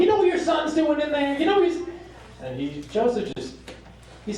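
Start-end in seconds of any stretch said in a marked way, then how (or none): 0.0-1.8 high-pitched hysterical nagging; acted frantic mother yelling at her husband; flipping out. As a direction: rail at him in a high voice. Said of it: "She starts flipping out on him".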